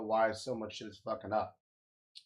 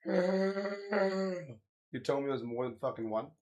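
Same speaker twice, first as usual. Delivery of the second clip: higher, slow, fake sobbing disbelief; hammed-up weepy outrage; mock crying; 4 semitones higher, slower than usual. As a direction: whine it like a fake cry. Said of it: "repeated in a mock crying voice".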